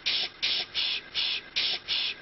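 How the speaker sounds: soft whispered shushing; hushing shh. Acted. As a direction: shush them quietly.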